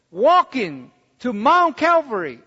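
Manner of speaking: ordinary talk, nothing marked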